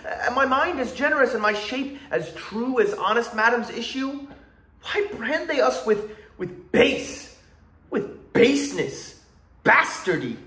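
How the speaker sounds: impassioned theatrical monologue, pitch often lifted high; Edmund. Acted. Performing King Lear monologue for viewers, as aggrieved Edmund. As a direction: declaim it with indignant, drawn-out key words. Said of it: "I'm gonna perform Edmund's monologue".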